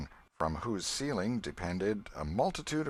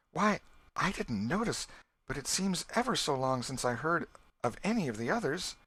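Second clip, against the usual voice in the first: higher, lightly voiced young girl, uneasy; a young girl noticing the silence; Becky's voice; 7 semitones higher than usual. A narrator's reading. Becky speaks in the cave.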